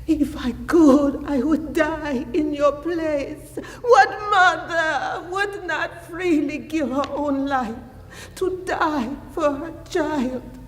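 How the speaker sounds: high, tearful anguished plea; anguished grief. Acted. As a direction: plead in grief, voice lifting towards tears. Mother grieving over her dying son.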